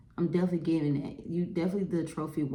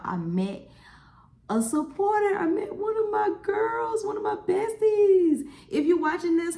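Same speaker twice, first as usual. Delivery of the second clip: much higher and louder, bubbling over; excited; 9 semitones higher, 3 dB louder than usual. Real, not acted. Telling viewers about meeting a fan, squealing with excitement. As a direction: gush about it like you can't hold it in.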